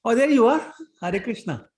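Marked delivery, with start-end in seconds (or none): 0.0-0.6 higher and louder, happily surprised; light up as you spot her on screen; delighted surprise. Real, not acted. Host spots joining student on video.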